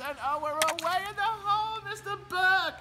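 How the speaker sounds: ecstatic call. Pretend. Announcer ecstatic at another hole in one.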